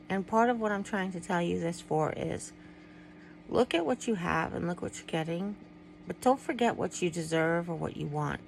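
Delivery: normal talking voice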